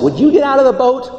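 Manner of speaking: loud, high, fast challenge; challenging question. Acted. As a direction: dare them to picture it. Preacher challenging the congregation.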